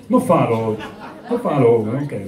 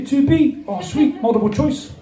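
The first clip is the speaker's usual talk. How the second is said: put-on higher-pitched New Zealand accent; Kiwi accent; 7 semitones higher than usual. Kiwi Shakespeare pleased by the choice, Kiwi accent. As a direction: play it easygoing with a Kiwi accent. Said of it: "have a Kiwi Shakespeare".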